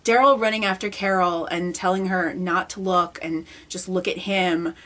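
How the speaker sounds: ordinary talk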